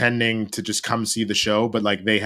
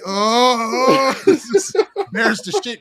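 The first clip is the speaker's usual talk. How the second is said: high, laughing cry of embarrassment; embarrassed laughing; 13 semitones higher, 4 dB louder, slower than usual. Retold or imitated. A teenager mortified by his sister in the theater, laughing. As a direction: laugh through the shame. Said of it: "I'm like".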